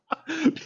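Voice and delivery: short high-pitched giggle. Real, not acted. Laughs at his own joke.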